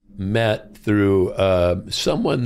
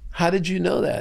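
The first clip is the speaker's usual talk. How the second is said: amazed question; 7 semitones higher than usual. Retold or imitated. Tony amazed, retold.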